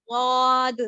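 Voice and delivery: ضاد the full heavy way, mouth filled; correct zwad demo. Shown as a demonstration. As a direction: say واد heavy and full, voice rising. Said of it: "پر کرنا ہے". (ضاد کو بھاری اور پُر آواز میں ادا کریں)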